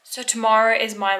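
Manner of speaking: normal talking voice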